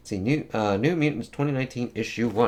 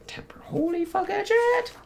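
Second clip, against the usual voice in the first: high, breathy whisper of disbelief; awed whisper; 4 dB quieter than usual. Pretend. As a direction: whisper it like you can't believe your eyes. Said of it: "And I'm like".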